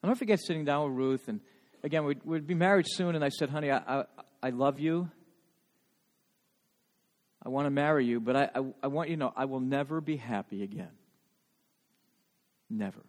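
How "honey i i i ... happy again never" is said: low, soft, halting retelling; subdued heartbreak, recalled rather than sobbed; grief-stricken confession. Retold or imitated. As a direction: replay a bereaved confession quietly, stumbling on the start. Retells grieving words to his fiancée.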